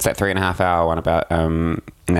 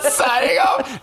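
high, slow laughing shout; mock sign-off; much slower than usual. Ending the reporter sketch, slow laughing high shout. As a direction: belt the sign-off through laughter.

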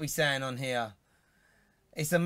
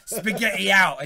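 loud, raised-pitch mock proclamation; imitated outburst; 4 semitones higher, 8 dB louder, slower than usual. Co-host quoting phone helper's spaghetti verdict, mock outburst. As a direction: call it out, imitating her. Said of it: "is what she said".

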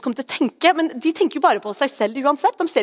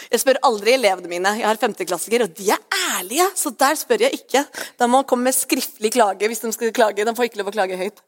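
ordinary delivery

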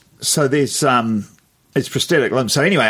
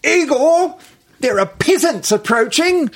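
very high, grand royal call; haughty queen voice. Retold or imitated. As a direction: summon your servant like a queen. Royal woman calls her servant.

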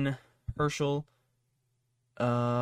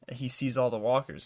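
ordinary talk, nothing marked

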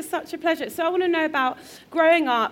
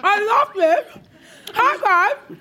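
high, loud, giddy delight; excited laughing greeting; 8 semitones higher, 4 dB louder, slower than usual. Real, not acted. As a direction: gush over everything you see.

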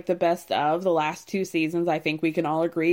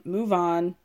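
ordinary talk

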